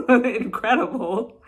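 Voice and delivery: loud, high, laughing exclamation; hammed-up ecstatic delight; mock gushing. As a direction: burst out laughing through the word. Mimics overblown joy, laughing.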